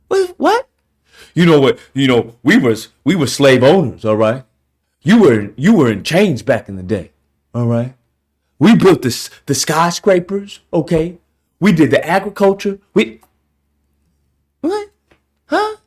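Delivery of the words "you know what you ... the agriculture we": mock boastful man. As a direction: puff up like a bragging guy. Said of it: "mocking repeated boasts".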